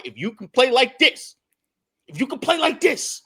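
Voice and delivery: high, loud, emphatic cry; excited exclamation. Real, not acted. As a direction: push it up high, thrilled by the player. Exclaiming over a player's great game.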